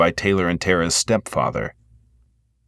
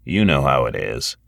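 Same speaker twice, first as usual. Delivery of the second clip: lower, easygoing security guard; 7 semitones lower than usual. A narrator's reading. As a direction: shrug it off, low and easy. Great Pumpkin shrugs it off.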